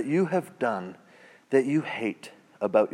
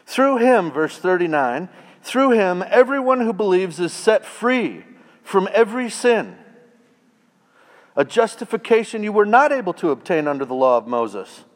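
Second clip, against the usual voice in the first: higher, louder preaching; preaching at full force; 6 semitones higher, 3 dB louder than usual. Acted. Preacher proclaiming freedom from sin to the congregation. Preaching climax on verse thirty-nine.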